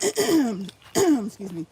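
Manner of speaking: loud throat clear, then excuse me. Real, not acted.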